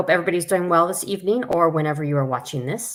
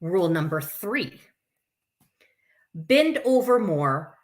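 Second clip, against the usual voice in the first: higher, lively storybook voice; children's story reading; 5 semitones higher than usual. Acted. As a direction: read it out to little ones, bright and sing-song. Reads picture book to kids.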